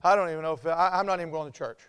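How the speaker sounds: low, sulky mumble of self-pity; mock moping. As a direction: play a dejected churchgoer giving up. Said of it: "Sit around and mope".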